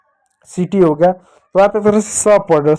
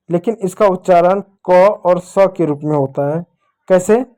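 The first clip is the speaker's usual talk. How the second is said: ordinary delivery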